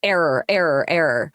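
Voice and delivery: flat machine-like error message; lightly voiced computer error; robotic error voice. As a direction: mimic a computer crashing. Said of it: "Just sort of like".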